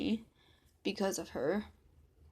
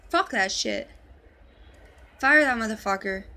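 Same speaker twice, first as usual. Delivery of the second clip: angry shout; 10 dB louder than usual. Retold or imitated. Vance yelling about attorney.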